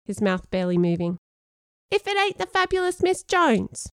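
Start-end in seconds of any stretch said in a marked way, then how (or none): 1.9-3.9 very high-pitched little creature voice; tiny worm voice. Acted. Sean greeting a friend through a biscuit worm, tiny high voice. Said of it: "in a tiny worm voice".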